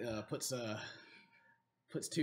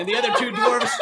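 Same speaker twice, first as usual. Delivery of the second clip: normal talking voice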